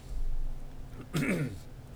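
coughing after swallowing wrong; hacking throat clear; choking cough. Real, not acted. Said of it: "this went down the wrong pipe".

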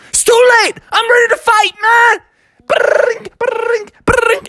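very high, loud battle-ready bird; a tiny bird shouting for a fight; fighting woodpecker. Acted. The woodpecker starts pecking Bubby, shouting.

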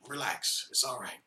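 calm reassurance. Acted. Jesus calming the disciples.

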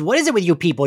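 ordinary delivery

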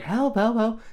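high screams of help; mock scream for help. Woman screaming to the policeman for help, mock panic. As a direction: scream for help across the road, hammed-up. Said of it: "She's real screaming".

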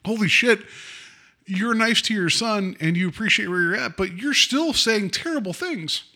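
shocked reaction. Retold or imitated. Acted shocked reaction to the mother.

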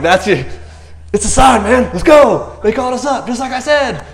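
high, loud, eager charge; rally your buddy, this is it; fired up. Retold or imitated. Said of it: "It's like".

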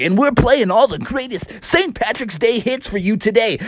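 radio DJ. A narrator's reading. DJ to listeners on Saint Patrick's Day, hyped announcer voice. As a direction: hype the hits like a radio host. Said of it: "the D J was like".

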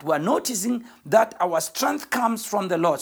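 normal talking voice

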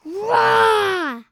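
loud playful roar; playful monster growl. Pretend. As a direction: roar like a big cat, loud and silly. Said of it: "Animal impressions".

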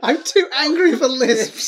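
high, slow, laughing. Real, not acted. Joking through laughter.

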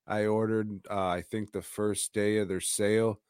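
ordinary delivery

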